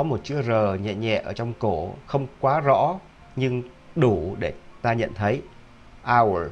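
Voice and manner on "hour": "Hour" with a light r in the throat, American style. Shown as a demonstration. Teacher showing students the American "Hour". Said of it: "là người Mỹ".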